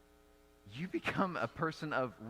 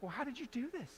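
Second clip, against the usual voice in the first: amazed question; 10 semitones higher, 6 dB quieter, faster than usual. Retold or imitated. Retold amazement at Tom.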